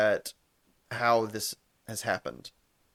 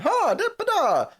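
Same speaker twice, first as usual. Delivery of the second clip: high, louder bouncy nonsense syllables; sing-song mock cheer; chipper sing-song; 7 dB louder than usual. Mimicking chipper Ted.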